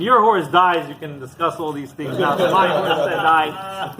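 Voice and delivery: loud, upset retort; mock-distraught protest, pushed loud; acted tearful screaming. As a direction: throw the comfort back at them, loudly. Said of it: "He's screaming, he's crying".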